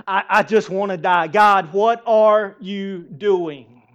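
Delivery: loud, drawn-out whine at God; whiny complaint. Retold or imitated. Jonah complains to God after the worm kills his plant, loud slow whine. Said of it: "He's like".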